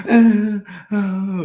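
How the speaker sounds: high wail, loud then easing; mock child's crying. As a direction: imitate a kid suddenly remembering to cry. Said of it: "he was supposed to be crying".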